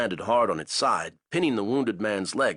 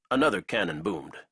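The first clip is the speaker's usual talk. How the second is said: ordinary delivery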